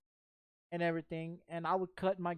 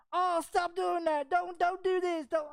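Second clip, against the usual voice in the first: put-on pleading girlfriend voice, much higher and slower; 10 semitones higher, 4 dB louder than usual. Narrator imitates girlfriend begging him to stop the fight, high pleading voice.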